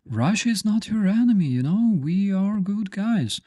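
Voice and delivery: high, fast, sugary mock reassurance; imitating a fake-friendly pundit; mock innocent propaganda. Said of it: "they go".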